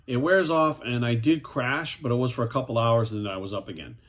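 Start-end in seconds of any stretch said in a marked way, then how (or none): none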